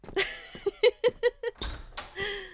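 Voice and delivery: a high, teasing laugh on the way out. Acted. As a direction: laugh off the teasing as she leaves. Laughs leaving the office.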